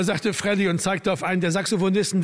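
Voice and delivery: ordinary delivery, nothing marked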